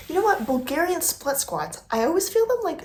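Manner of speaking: high, animated gym rant; excited. Real, not acted. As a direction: pitch jumps up with enthusiasm. Excited aside about squats.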